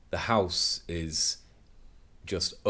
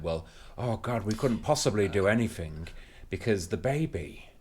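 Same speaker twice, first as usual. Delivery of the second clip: a hint of an anxious parent who can't cope; mock fretful parent. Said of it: "parents who are the other way".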